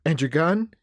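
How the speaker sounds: put-on insistent little boy voice; prodding boy. A narrator's reading. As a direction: nudge for an answer like a curious kid. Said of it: "he prodded".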